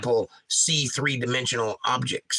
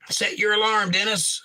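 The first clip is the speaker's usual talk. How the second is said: high and loud, calling Dennis awake; mock alarm bell read-out; emphatic alarm call; 8 semitones higher, 3 dB louder than usual. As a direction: shout it like a wake-up call. Reading chat message out emphatically.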